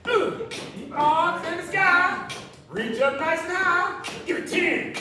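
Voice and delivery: high, loud pumped-up rep count; excited countdown. Acted. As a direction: whoop between counts to fire them up. Counting down reps, whooping.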